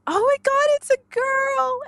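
put-on mother announcing it's a girl; thrilled. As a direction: burst out in joy.

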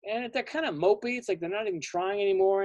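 plain reading voice

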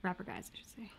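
whispered correction; hushed aside. Real, not acted. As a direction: drop to a whisper to fix the word.

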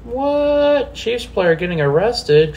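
high fake gasp of shock; mock surprise. Reacting to Chiefs arrest news with mock surprise. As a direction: play it as fake astonishment.

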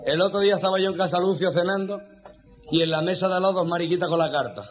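usual speaking voice

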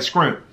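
"Scrimp" said short and quick; fast home-style "Scrimp". Shown as a demonstration. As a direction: snap out "Scrimp" in one beat. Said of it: "It's like".

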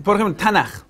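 a hint of a smug debater; mock insistent claim. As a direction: lay out their claim a bit pompously. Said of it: "Tú dices, ellos dicen".

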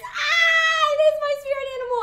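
high, loud, rushed shout of delight; fast gleeful yell; excited shout. Real, not acted. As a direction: yell it out, thrilled. Excited about Pokemon.

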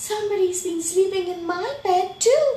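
high, loud, quick dismay; shocked Mommy Bear. A narrator's reading. Mommy Bear finding her bed slept in, high-pitched shock. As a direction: pitch it way up, rising at the end.

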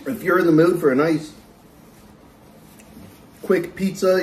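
talking through a napkin; finishing a bite, then speaking; chewing, wiping mouth. Real, not acted. Seen 3: Just bit pizza; wipes fingers, then napkin over mouth mid-speech.

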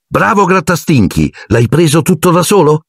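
high, cooing praise; praising a pet. A narrator's reading. Hermione to her cat after its catch, high doting praise. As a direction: talk to the cat like a proud owner.

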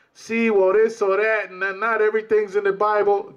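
mocking evasive brother. Preacher mocks brothers who dodge scripture, sing-song excuse. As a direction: mimic the brother dodging the scripture. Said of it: "coming sideways with".

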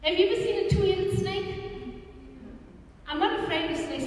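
ordinary talk

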